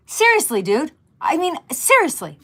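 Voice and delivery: high, loud exasperated seriously; fed up, half-laughing disbelief. Real, not acted. Wife fed up with husband's early harmonica, exasperated.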